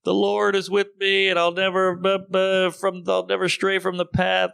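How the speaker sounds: a touch of a sanctimonious believer; mock pious poster. Mocking religious Facebook posters, mild pious parody.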